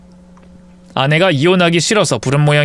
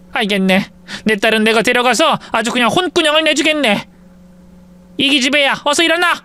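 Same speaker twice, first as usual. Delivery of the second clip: put-on higher, scolding old mother; scolding mother-in-law; 8 semitones higher than usual. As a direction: order your daughter up as a cross mother. Mother-in-law scolds her daughter.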